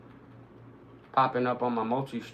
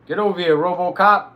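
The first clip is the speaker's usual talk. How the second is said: higher, much louder repeat of the taunt; shouting louder; 5 semitones higher, 11 dB louder than usual. Real, not acted. Streamer yelling the enemy over again, louder and higher. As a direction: bellow it at him this time.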